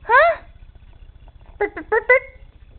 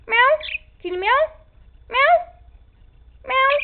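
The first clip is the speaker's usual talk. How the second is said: cat meow; 10 semitones higher, 5 dB louder than usual. Retold or imitated. Asks bird to meow.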